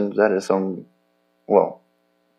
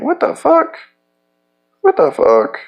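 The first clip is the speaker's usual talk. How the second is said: shocked outcry; 4 dB louder than usual. Retold or imitated. Shocked while being punched.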